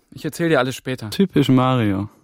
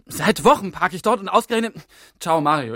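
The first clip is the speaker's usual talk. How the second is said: higher, louder exasperated protest; upset outburst; 7 semitones higher, 3 dB louder than usual. Acted. Mark sees his car towed while fleeing, exasperated outburst.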